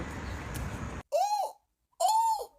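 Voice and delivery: squealing high ooh of delight; a shrieked, high-pitched ooh; high falsetto ooh. Real, not acted. Reacting to the Uber story with a high squeal.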